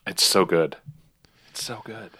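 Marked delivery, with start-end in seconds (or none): 1.5-2.1 much softer, almost under breath; quiet echo. Real, not acted. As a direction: murmur it back to yourself. Echoes it far softer.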